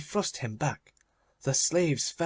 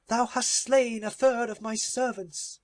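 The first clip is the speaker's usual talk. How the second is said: Avarice shrieking over her dead servants, much higher; cried aloud; 11 semitones higher than usual. Acted. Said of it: "cried aloud".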